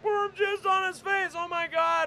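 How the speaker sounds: high, excited blurting; scandalized bystander. Acted. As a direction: squeal the news in mock shock. Acted bystander blurting the news.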